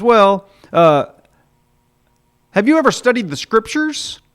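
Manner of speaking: a fussy examiner in a put-on voice; prim angel.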